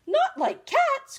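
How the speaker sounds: very high, loud, slow shrieking outrage; shrill passionate mouse. A narrator's reading. Mouse to Alice about cats, shrill outraged squeak. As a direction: squeal it, stunned and offended. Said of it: "in a shrill, passionate voice".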